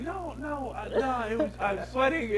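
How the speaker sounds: a hint of a girl caught crying; imitating her denial. Voicing the girl denying her tears.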